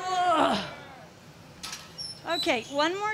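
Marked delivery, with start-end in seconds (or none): 0.1-0.6 loud effortful grunt, a bit higher; grunting noise. Shown as a demonstration. For the students as they push, a loud grunt. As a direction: push out a hard grunt for the group. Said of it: "Everybody make the grunting noise".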